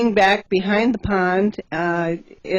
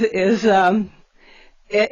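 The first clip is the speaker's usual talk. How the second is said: ordinary talk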